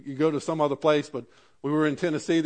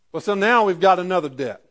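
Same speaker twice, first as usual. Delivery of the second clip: usual speaking voice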